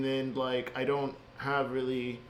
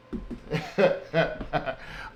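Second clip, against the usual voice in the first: exasperated laugh. Real, not acted. Laugh after outburst.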